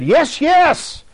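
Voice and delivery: very high, strained demon answer. Retold or imitated. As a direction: squeeze it out like a spirit speaking through her. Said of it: "And a voice said".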